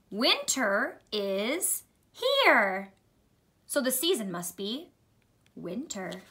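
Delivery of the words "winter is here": very slow, word by word, with rises; slow guided reading. Shown as a demonstration. Teacher modelling reading for children, very slow and deliberate. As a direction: read each word separately for beginners.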